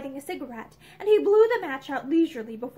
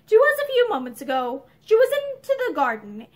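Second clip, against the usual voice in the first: ordinary delivery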